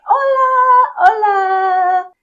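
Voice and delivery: high, loud sing-song greeting; sing-song hola. Pretend. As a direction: sing the hello out brightly. Cheerful sung greeting to driver.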